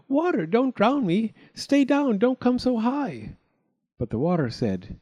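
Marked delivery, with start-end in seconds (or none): 0.1-3.4 high, frantic storybook cry. A narrator's reading. Drowning chick begging the water, panicked cry. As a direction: plead in alarm, pitched way up. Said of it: "little Half Chick cried out".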